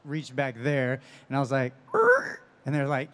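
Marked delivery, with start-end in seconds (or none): 1.9-2.4 shrill high goblin screech, much louder; a squealing monster cry, like talking to a pet guinea pig. Shown as a demonstration. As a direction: let out a sharp goblin shriek. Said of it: "just make a goblin noise".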